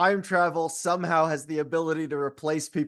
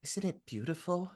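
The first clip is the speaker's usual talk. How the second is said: dreamy; 5 semitones lower, 7 dB quieter, slower than usual. Acted. Entranced daughter admiring the destruction.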